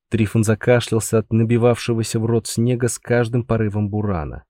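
ordinary delivery

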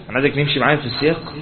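ordinary talk, nothing marked

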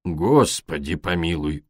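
slow, low, awed oath; low drawn-out gasp of wonder; blurted amazement. A narrator's reading. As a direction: let it slip out in astonishment. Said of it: "вырвалось у него".

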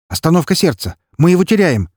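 высокий, громкий крик врача; кричи тревогу коллегам; тревожный крик. Acted. Врач выбегает из палаты. (врач в панике кричит из палаты)

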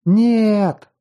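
high anguished cry of refusal; cry full of grief. A narrator's reading. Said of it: "Крик, полный горя, обиды, злости и ненависти".

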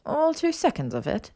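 high, teasing female voice; mocking singsong put-down; trailing-off tease. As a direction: dangle the jab in a lifted pitch. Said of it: "she trailed off".

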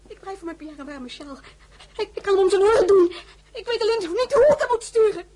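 very high, distraught wail; desperate plea. Acted. Marie begs the emperor to send a shawl, distraught. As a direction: beg in high, anguished cries.

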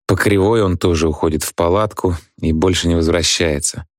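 ordinary delivery, nothing marked

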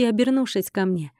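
ordinary delivery, nothing marked

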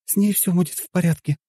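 high-pitched reassuring woman; high voice with a smile; smiling reassurance. A narrator's reading. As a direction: soothe a worried husband in a high female voice. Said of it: "улыбнулась Вика".

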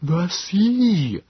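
high, drawn-out creature plea; Nebogipfel. A narrator's reading. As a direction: plead high, stretching the word out. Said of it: "Nebogipfel said".

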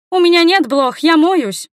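put-on high, loud girlish protest; indignant girl. A narrator's reading. Girl indignantly denies having fleas.

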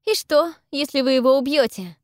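high, loud question; urgent, defiant questioning; defiant challenge. A narrator's reading. Heroine challenges the lord.

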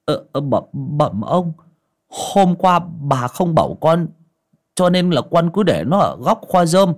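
bow and scrape as the servant; timid servant. Acted. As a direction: servant boy answers his master, fawning.

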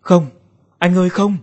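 high cry of horror; anguished scream. A narrator's reading. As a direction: cry out in dread for a brother. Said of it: "Tôi gào thét". (tiếng gào kinh hoàng)

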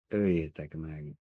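slow, low, soft murmur; mumbling. A narrator's reading. Old woman urging them to kill the child, mumbling. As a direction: mumble it weakly, drawn out. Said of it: "marmogta az öregasszony".

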